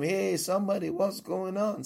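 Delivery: high-pitched calling out for somebody; mock scream, pitched well up; acted scream. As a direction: call out urgently to anyone nearby. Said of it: "or scream".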